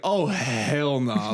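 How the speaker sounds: slow, drawn-out disbelief; emphatic refusal. Retold or imitated. Told he may sleep there, drawling refusal. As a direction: drag it out in mock dismay. Retold reaction to bad news.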